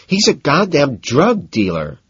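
high, slow, exasperated drawl. A narrator's reading. Elizabeth, exasperated, tells Patrick about her dealer. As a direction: roll your eyes at the obvious. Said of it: "she says, exasperated".

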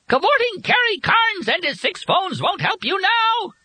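extremely high, piercing shriek; shrill it like an enraged bird. A narrator's reading. Bird hovering over the sea, shrieking wildly. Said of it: "the bird shrilled".

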